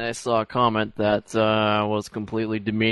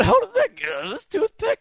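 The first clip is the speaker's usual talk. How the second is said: fake innocent voice; 5 dB louder than usual. Playing innocent fighter.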